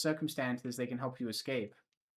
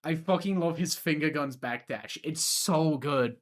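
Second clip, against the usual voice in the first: excited; 3 semitones higher, 6 dB louder, slower than usual. Real, not acted. Gushing about backdash.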